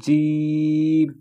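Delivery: জিম held long and pressed hard; hard Jeem. Shown as a demonstration. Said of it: "শক্ত কীরকম".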